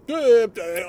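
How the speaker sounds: high, loud duh duh; loud mock duh. Showing how he mocks teen talk, loud high duh. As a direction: blurt it out mockingly. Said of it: "I can go".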